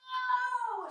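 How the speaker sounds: loud, high, drawn-out oh; big stagey aha; dawning realization. Acted. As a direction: let the penny drop audibly. Sudden understanding.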